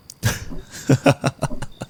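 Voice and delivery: breathy high giggle; a playful wicked giggle; high teasing laugh. Acted. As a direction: laugh the threat off. Laugh after "not kidding.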